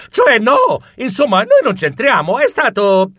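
high, loud nervous protest; flustered denial. Acted. Flustered denial to accusing warriors.

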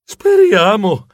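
slow, high, drawn-out hope; sospirando. Acted. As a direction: stretch it out doubtfully. (detto lento, come un sospiro)

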